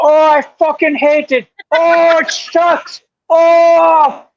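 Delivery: loud, high hammed-up Irish rant; mock Irish accent. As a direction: go off in a drawn-out fake Irish accent. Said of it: "with that fucking accent".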